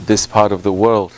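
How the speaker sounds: ordinary delivery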